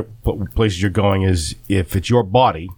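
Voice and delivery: ordinary delivery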